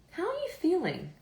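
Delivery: high-pitched, mildly acted doctor's question; lightly voiced doctor. Doctor asks her patient at the appointment, lightly imitated high voice.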